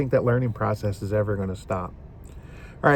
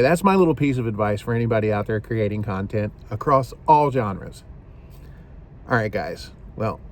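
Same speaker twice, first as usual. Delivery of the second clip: ordinary talk, nothing marked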